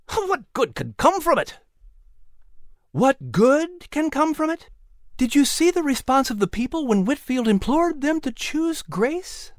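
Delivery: Sarah challenging her uncle, worked up; shooting back. A narrator's reading. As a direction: fire the question back at him. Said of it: "Sarah shot back".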